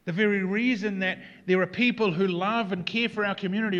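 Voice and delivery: loud, driving sermon climax; preaching at full force. Acted. Pastor rousing his congregation with a loud, driving preach. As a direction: preach it out to the whole room.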